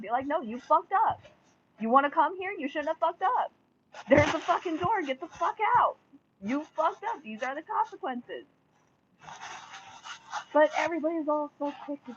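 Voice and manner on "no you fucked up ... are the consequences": higher, louder, scolding the venue; telling them off. Acted. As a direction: give them a furious dressing-down.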